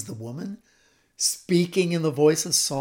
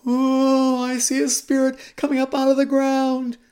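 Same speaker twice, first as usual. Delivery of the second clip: loud, high séance wail; hammed-up medium calling out her vision; entranced medium; 8 semitones higher, 9 dB louder than usual.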